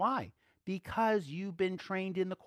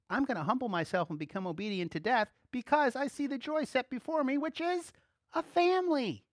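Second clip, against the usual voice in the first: climbing higher toward a loud peak; rising fervor; 9 semitones higher, 3 dB louder than usual. Acted. Preaching Jesus' words, building to a high climax. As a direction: build up to the joy. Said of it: "Jesus said".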